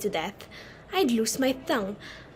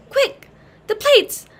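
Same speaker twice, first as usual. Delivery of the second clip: urgent call; 8 semitones higher, 7 dB louder than usual. Acted. Daughter calls the butler urgently.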